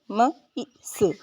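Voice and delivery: each sound of म ई स said separately. Shown as a demonstration. Teacher breaking down mystery for learners, separated sounds. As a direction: say म, ई, स slowly, one by one. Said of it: "इन सब में वाई की sound ई निकल रही है".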